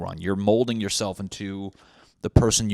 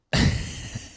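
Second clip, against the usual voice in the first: silent laugh. Real, not acted. Laughing at own joke.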